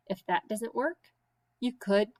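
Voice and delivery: plain reading voice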